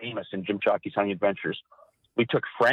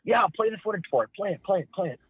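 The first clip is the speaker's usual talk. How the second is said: higher, eager push to play the tape; eager Jim; 8 semitones higher than usual. Retold or imitated. Cameraman's boss, eager and higher-pitched. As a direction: Jim hurrying him to play the footage. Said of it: "And Jim's like".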